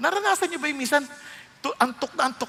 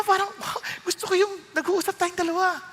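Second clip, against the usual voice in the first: ordinary talk, nothing marked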